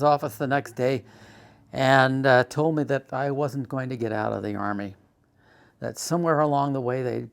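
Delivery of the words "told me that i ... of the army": ordinary delivery, nothing marked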